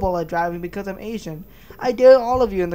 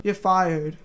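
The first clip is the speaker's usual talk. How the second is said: ordinary talk, nothing marked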